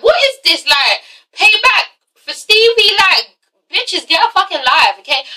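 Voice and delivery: high, slow mock disbelief; exasperated outburst. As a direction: throw up your hands at the pettiness.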